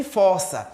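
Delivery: fossa said as "fórça", with an open "ó"; open O in força. Shown as a demonstration. Teacher naming the verb beside its homograph, open O in fossa. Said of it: "Acontece isso também com a palavra".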